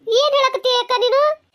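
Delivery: loud, high rising question; shocked disbelief. Acted. Mother learns who told on her daughter, shocked disbelief. Stunned by friend's revelation.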